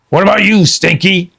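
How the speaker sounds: high, loud pirate shout; Captain Bones calling to Stinky; roaring pirate captain. Acted. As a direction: roar up the ladder. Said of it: "Captain Bones roared".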